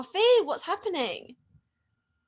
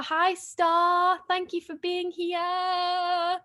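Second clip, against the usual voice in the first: sweet greeting; 7 semitones higher, 6 dB louder, much slower than usual. Real, not acted. Host greeting fan Star in the chat, higher and slow sweet welcome. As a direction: lift the voice and linger on the thanks.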